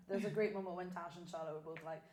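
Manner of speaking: ordinary delivery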